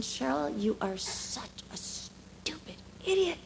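hissed whisper of self-contempt; harsh self-talk. Retold or imitated. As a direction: whisper the insult to yourself in scorn.

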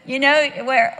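usual speaking voice

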